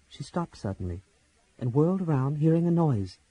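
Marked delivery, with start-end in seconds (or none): none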